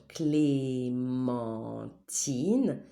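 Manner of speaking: "clémentine" in long drawn-out syllables. Shown as a demonstration. Teacher showing where the sound is, syllable by syllable. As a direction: stretch clé, men and tine slowly.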